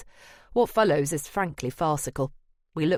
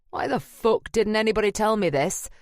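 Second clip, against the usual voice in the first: higher-pitched, angry outburst short of a shout; almost shouting; 6 semitones higher than usual. A narrator's reading. JB demanding answers from the gallery team, almost shouting. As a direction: raise the voice in mild acted outrage. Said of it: "He's almost shouting now".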